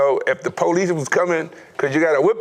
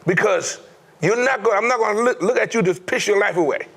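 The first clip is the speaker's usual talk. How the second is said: a raised, fast-starting coach's scolding, pitched high; angry coach; 9 semitones higher than usual. Retold or imitated. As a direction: bark it at him like a furious coach. Coach pins him, scolds him.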